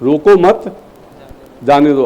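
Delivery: "रोको मत", a clear pause, then "जाने दो"; pause after मत. Shown as a demonstration. Teacher shows the comma's effect, pausing after "मत". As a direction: break after "मत" so "जाने दो" stands alone. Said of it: "केवल कॉमा लगाने से".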